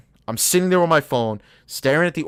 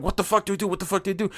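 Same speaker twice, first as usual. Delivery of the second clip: panicked muttering; 6 semitones higher, 4 dB quieter, much faster than usual. Retold or imitated. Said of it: "shitting myself, thinking".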